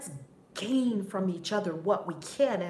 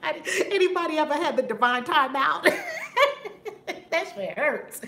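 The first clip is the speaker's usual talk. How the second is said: talking through laughter, higher, louder; laughing; 8 semitones higher, 6 dB louder than usual. Real, not acted. Laughing while asking audience.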